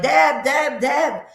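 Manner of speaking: yüksek sesle seslenen koca taklidi; calling out. Retold or imitated. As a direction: onu bulmaya çalışırken adını seslenerek çağır. Husband calling out her name.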